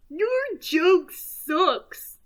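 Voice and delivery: high, loud heckler voice. Acted. Fist character heckles the comedian's joke, jeering.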